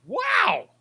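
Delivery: can't believe it's back; a high, delighted gasp of a word; amazed wow. Real, not acted. Reacting to the beer in a can.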